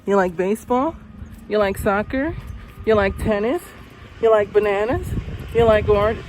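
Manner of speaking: high, bright coaxing teacher questions; teacher coaxes a silent boy, high and eager. Retold or imitated. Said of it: "I said".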